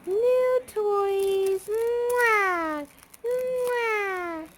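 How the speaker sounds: very high, loud squeal with big kissy sounds. Real, not acted. Excited toy play with baby.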